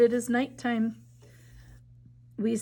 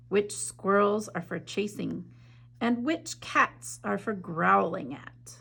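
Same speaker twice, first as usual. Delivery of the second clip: story narration. A narrator's reading. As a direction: read it like a bedtime story to children. Reads picture book aloud.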